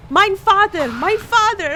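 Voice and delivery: loud, high mock yell in German; retold scream. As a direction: shout for your father like the caller did. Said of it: "was just screaming".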